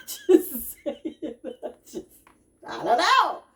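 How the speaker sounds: high, loud, laughing disclaimer. Real, not acted. Host after telling critics to leave, laughing it off. Laughs off her blunt advice.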